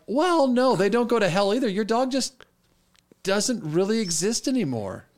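high-pitched, floundering retold answer; hapless reassurance. Retold hapless pastor answering grieving student.

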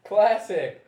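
loud, high cheer with a laugh in it; delighted exclamation. Real, not acted. Host cheering a friend's costume idea, delighted exclamation. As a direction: echo it back in loud delight.

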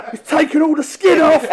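pained shout. Retold or imitated. Dave crying out to his mate, high pained shout. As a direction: holler it like your skin's raw. Dave in pain on bed chair.

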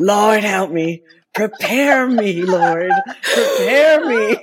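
slow, loud pleading to the Lord; mock prayer. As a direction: beg the Lord, hammed-up and slow. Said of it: "I'm like".

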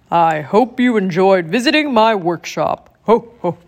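put-on a warm, jolly old Santa, fuller in tone. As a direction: speak as a kindly Santa Claus. Santa farewell to visitors.

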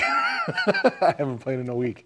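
slow repeat broken up by real laughter; laughing. Real, not acted. Admitting a week without playing, laughing.

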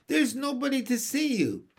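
high-pitched, lightly teasing remark; amused. A narrator's reading. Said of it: "in her soft, amused voice".

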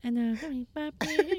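jokingly boasting about being popular; teasing brag. Pretend. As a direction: tease them by showing off playfully. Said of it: "Sorry, I'm giving you a tease".

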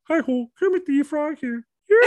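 very high puppet frog voice; Kermit the Frog. Acted. Host showing off their own impression, high puppet frog voice. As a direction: do a high-pitched Muppet greeting. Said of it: "You're not the only one that has a".

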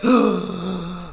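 sharp mock-shocked gasp.

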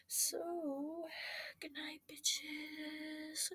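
high, slow, drawn-out goodbye; sing-song sign-off. Real, not acted. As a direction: stretch the goodbye playfully. Sign-off at end of video.

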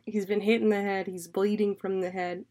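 ordinary talk, nothing marked

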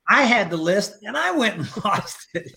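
laughing through the words. Real, not acted. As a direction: chuckle at your own slip. Laughs at losing the list.

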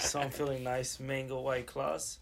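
soft, slightly tipsy talk; a little buzzed after a few drinks. Real, not acted. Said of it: "I'm a little tipsy".

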